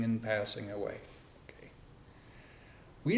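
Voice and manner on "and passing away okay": soft whisper. Real, not acted. Teacher trailing to a whisper.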